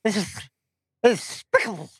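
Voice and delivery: lisping cartoon duck with spitting; Daffy Duck voice. Shown as a demonstration. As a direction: spit out the words like Daffy. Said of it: "explain it like you're Daffy Duck".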